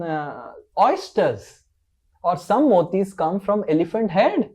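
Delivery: put-on incredulous mother setting her child straight; Mother Yasoda. Mother corrects Krishna about where motis come from, incredulous. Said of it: "Mother Yasoda says".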